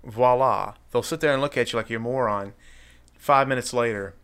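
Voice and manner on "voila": mock French voila. Shown as a demonstration. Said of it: "Or as the French say".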